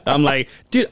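ordinary delivery

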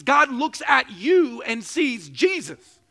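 slow, loud, rising preacher's cry; preaching climax. Real, not acted. Pastor preaching to his church, loud and slow. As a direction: build to the peak of the sermon.

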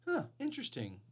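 high sarcastic "Huh, interesting"; dry fake interest. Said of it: "a sarcastic way of saying".

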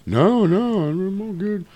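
high-pitched brush-off; reassuring Rudolph. Retold or imitated.

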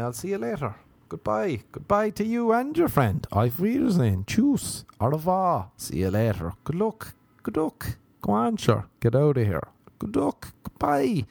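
high-pitched rattling string of goodbyes; see them off in a comic high voice; mock-cheery brush-off. Man dismissing the insulting girl, mock-cheery high farewells.

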